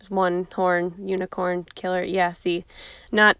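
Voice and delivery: normal talking voice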